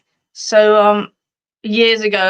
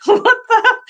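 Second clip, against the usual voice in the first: much higher, louder, laughing in disbelief; shocked laugh; 13 semitones higher, 5 dB louder than usual. Retold or imitated. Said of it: "I'm just like".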